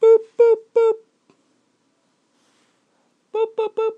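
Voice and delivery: very high squeaky boop sounds. Acted. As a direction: squeak like a toy for the baby. Making silly noises for baby.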